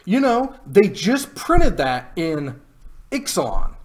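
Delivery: loud, high-pitched exasperated complaint; exasperated rant. Real, not acted. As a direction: vent at the card designers. Rant about unbanning Jace.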